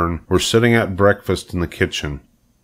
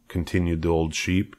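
plain reading voice

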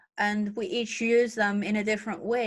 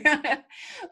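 laugh; 6 semitones higher than usual. Real, not acted. Laughs at own joke.

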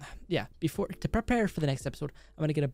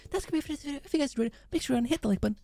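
much higher, bright YouTuber outro; outro sign-off; 11 semitones higher, much faster than usual. Acted. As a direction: sing out the goodbye to viewers.

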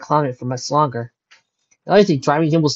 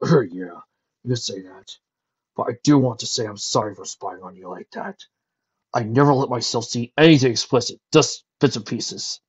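guilty dragon owning up; sheepish dragon voice. Acted. Spike apologizing.

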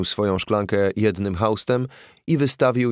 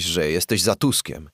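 ordinary delivery, nothing marked

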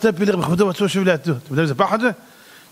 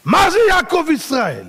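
shouted self-rebuke; 10 semitones higher than usual. Acted. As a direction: yell at yourself as the strict rabbi. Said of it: "פתאום הוא שומע צעקות". (צעקת תוכחה רמה)

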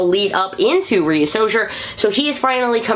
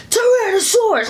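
morpher voice callout; 12 semitones higher than usual. Retold or imitated. Kid imagining a morpher toy, high excited callout. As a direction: shout it like the morpher announcing. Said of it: "you hit Tyrannosaur".

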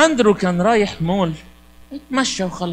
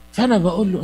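ordinary talk

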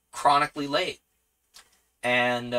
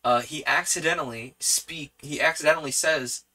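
normal talking voice

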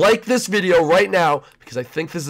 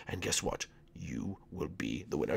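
whispered, much softer reveal; hushed conspiratorial tip; secret whisper; 11 semitones lower, 18 dB quieter than usual. Acted. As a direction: let them in on a secret. John Wick whispers the secret.

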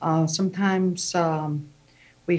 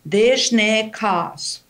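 slow demo; much slower than usual. Shown as a demonstration.

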